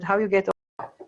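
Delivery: ordinary talk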